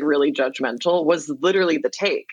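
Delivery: usual speaking voice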